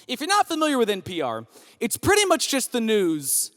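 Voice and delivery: ordinary delivery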